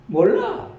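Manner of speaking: put-on a country old woman talking about her daughter-in-law; Gyeongsang grandma. Grandma guesses Jesus is the in-law, in high Gyeongsang dialect. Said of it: "경상도 버전으로".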